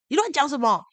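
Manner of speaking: defensive retort. Retold or imitated. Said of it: "那男的马上转过来就说".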